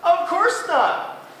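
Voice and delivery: high, slow, loud friendly moose; a kind moose agreeing happily. Acted. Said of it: "smiled Fidwick".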